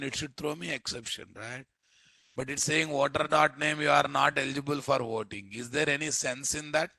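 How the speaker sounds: ordinary delivery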